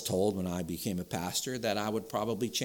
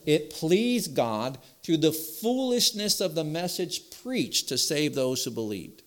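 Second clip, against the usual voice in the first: impassioned reading; 8 semitones higher, 5 dB louder than usual. Acted. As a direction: lift it up like a treasured line. Preacher proclaims favorite verse.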